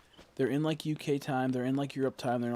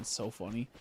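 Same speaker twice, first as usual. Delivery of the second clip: normal talking voice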